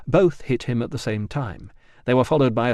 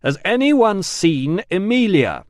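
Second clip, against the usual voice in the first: loud, slow, high shout; shouting across an echoing hall; shout over noise; 8 semitones higher, 6 dB louder, slower than usual. Acted. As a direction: call out over a din of screams.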